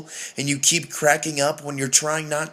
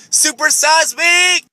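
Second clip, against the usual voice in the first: higher, louder drawn-out shout; shouted punchline; 10 semitones higher, 6 dB louder, slower than usual. Acted. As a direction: yell it like a slogan.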